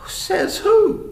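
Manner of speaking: high, loud taunting question; cocky, high-pitched comeback; defiant challenge. Acted. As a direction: throw it back as a dare. Boys challenge Moses back.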